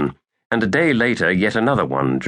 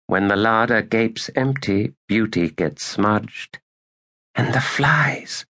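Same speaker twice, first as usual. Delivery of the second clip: put-on higher, slow, hushed girl's voice; Badal half-whispering; 3 semitones higher than usual. A narrator's reading. Badal muses on lost beauty, hushed, high and slow. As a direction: let each word drop slowly, hushed and higher. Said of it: "she whispered".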